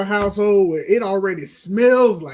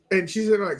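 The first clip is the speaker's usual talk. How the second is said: ordinary talk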